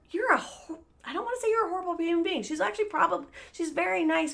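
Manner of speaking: fast, high, flustered; catching herself mid-insult; hurried backpedal. Real, not acted. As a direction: rush to take it back.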